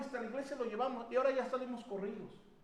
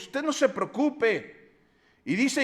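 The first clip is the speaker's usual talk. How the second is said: ordinary talk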